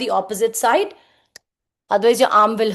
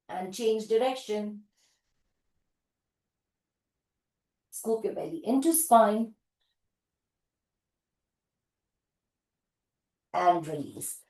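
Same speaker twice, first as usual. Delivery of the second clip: soothing cool-down; 3 semitones lower, 9 dB quieter than usual. Real, not acted. Cool-down release, soothing cues.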